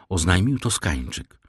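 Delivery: plain reading voice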